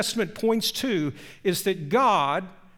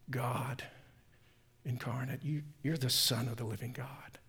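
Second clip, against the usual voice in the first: hushed, much softer whisper; reverent whisper; 7 semitones lower, 12 dB quieter than usual. A narrator's reading. Simon confesses Jesus as God, in an awed whisper. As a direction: breathe it out in awe.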